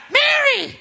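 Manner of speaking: very high, loud squeaky falsetto; Elmo. Acted. Mary answers the disciples outside, Elmo-like. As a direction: squeal it like a puppet. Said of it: "That sounded like Elmo".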